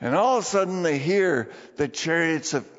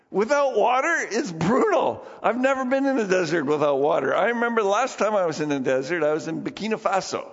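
ordinary delivery

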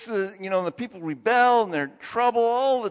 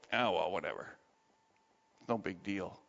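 low, soft, mumbled brush-off; casually waving it away, much quieter and lower; dismissive shrug; 11 semitones lower, 9 dB quieter than usual. Acted. We go like this when God confronts us, dismissive. Imagined brush-off of God's call.

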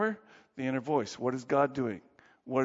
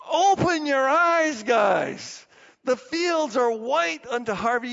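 much higher and louder, fervent cry; Jesus crying out; 9 semitones higher, 7 dB louder, slower than usual. Acted. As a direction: cry it out as Jesus urging them to see. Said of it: "And Jesus is like".

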